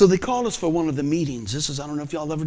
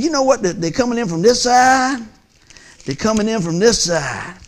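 loud, high preacher's build; preaching; 5 semitones higher, 7 dB louder than usual. Acted. Preacher rousing the church with his family story, loud and high. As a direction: rouse the congregation, full voice.

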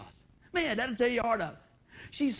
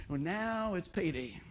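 ordinary talk

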